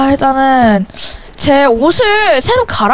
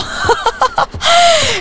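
high-pitched squealing giggle; delighted giggle; 14 semitones higher than usual. Real, not acted. Player sees the baby dressed as an elf, high delighted giggle. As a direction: giggle high at something cute.

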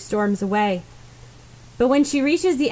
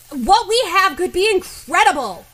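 much higher and louder, a bellowed bark; barking outburst; 10 semitones higher, 7 dB louder than usual. Acted. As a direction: bellow it at her like a guard dog. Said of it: "Bakugo barks like a dog".

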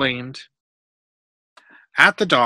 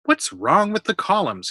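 put-on higher, lilting child's question; curious question; 8 semitones higher, 4 dB louder than usual. A narrator's reading. Curious boy asking the workmen about columns, inquisitive. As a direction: pipe up inquisitively.

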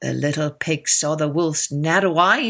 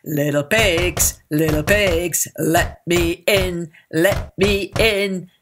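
wolf voice; slower than usual. A narrator's reading. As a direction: draw it out like a big bad wolf. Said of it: "and he said".

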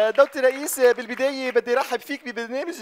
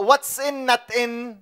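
put-on Arabic-accented English; much slower than usual. Broken English, very slow.

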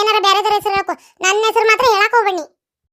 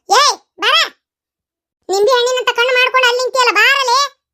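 calling out; 4 semitones higher than usual. Acted. Calling friend away to leave.

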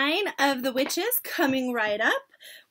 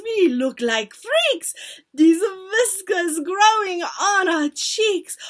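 higher, drawn-out wailing shout; child's shout; 6 semitones higher, 5 dB louder than usual. A narrator's reading. Witch mimics children sprouting whiskers, shouting. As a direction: call it out in acted dismay. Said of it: "Another shouts".

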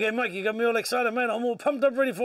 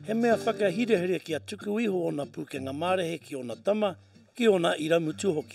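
measured Māori voice-over; documentary narration; 3 semitones lower than usual. Acted. Narrator introducing the hunting show, voice-over. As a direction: tell the story over the footage.

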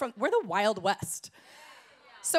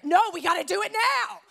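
higher, louder mock impatience; insistent and rushed; impatient Californian; 8 semitones higher, 7 dB louder than usual. Californian insisting to the pastor, higher mock impatience.

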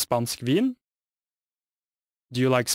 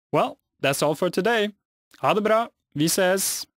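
cheerful goodbye; 7 semitones higher than usual. Real, not acted. Cheerful lesson sign-off.

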